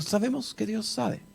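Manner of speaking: very soft, easy imitation; relaxed assurance. Travelling pastors always relaxed about God, imitated soft assurance. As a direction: imitate unworried certainty, almost under the breath. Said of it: "siempre estaban relajados".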